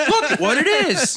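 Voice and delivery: cracking up while mimicking his catchphrase; giggling parody of the host's awkward line; laughing imitation. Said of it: "what a weird way to say that".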